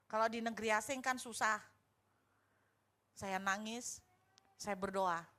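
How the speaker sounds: ordinary talk, nothing marked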